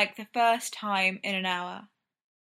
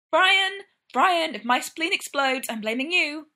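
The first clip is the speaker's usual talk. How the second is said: high, louder protest; exasperated warning; 7 semitones higher, 5 dB louder than usual. A narrator's reading. Brendan squeezed by laces blaming Ryan, exasperated. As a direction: call out the name sharply, then complain. Threatening Ryan over tight corset.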